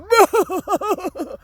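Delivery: mocking stupid laugh; high, put-on laugh imitating a politician; DeSantis. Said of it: "that stupid fucking laugh of his".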